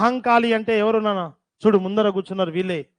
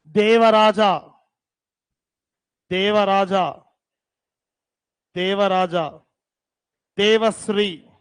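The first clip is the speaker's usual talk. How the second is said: Devagupta drawn out very slowly, rising at the end; slow dictation; slower than usual. Shown as a demonstration. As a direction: stretch out Devagupta syllable by syllable for the students to write. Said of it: "right". (Devagupta ని చాలా నెమ్మదిగా సాగదీసి చెప్పడం)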